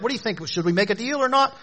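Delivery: high, lighter questioning voice; mock negotiating parties. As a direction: play two parties tentatively haggling. Imagined parties negotiating a deal.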